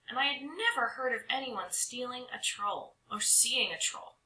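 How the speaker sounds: usual speaking voice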